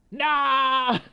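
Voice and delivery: high, loud, dramatic plea; over-the-top yell of despair; anguished outcry. Real, not acted. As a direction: cry out in loud dismay. Pleads in dismay at game line.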